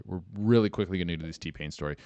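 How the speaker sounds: ordinary talk, nothing marked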